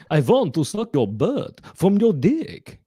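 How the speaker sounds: high, slow comic Dracula; Bela Lugosi. Acted. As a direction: ham it up as a vampire. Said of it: "So this is Bela Lugosi".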